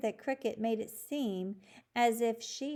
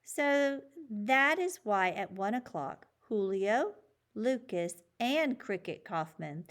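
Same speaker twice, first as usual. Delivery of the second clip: ordinary delivery, nothing marked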